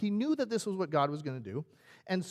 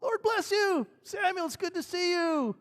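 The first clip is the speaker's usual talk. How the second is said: high, loud, gleeful greeting; happy Saul; 14 semitones higher, 7 dB louder than usual. Acted. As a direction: gush it out, delighted with yourself. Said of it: "And he's all happy".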